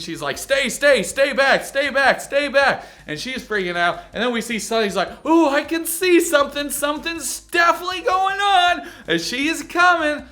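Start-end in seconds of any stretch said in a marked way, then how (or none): 0.4-2.9 louder, high repeated warning; high-pitched, urgent mock panic. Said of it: "she's panicking". 5.3-10.2 high-pitched mock excited suspicion; hammed-up thrilled discovery; Sonny. Imitates Sonny suspecting something.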